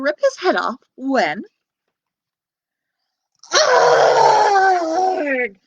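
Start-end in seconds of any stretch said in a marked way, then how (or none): 3.5-5.6 loud high pained yell; giant's yell. Acted. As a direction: cry out falling into a pit. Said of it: "he yelled".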